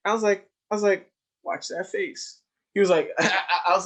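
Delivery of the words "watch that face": a voiceless playful whisper; hushed mock warning; half-whispered tease. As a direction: tease him under your breath. Teasing kid in hallway.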